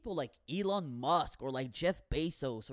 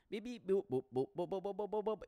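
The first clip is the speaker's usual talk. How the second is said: rapid robotic beeps and boops; phone beeps; 2 semitones higher, 4 dB quieter, much faster than usual. Retold or imitated. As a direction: rattle off electronic blips. Imitates billionaire working his phone.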